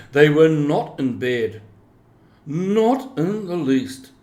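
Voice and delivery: usual speaking voice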